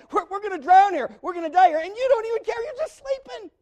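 high, loud, frantic cry of men about to drown; panicked disciples. Acted. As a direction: shout it like you're sinking in a storm.

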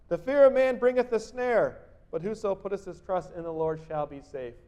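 loud proclaimed psalm; scripture reading. Acted. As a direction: read it out as a promise. Preacher reads proverb aloud.

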